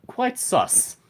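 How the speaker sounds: amused, put-on British voice; British accent. Shown as a demonstration. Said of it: "Quite sus in a British accent".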